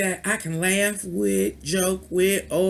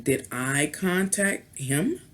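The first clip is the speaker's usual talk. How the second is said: usual speaking voice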